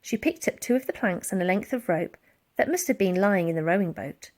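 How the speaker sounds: plain reading voice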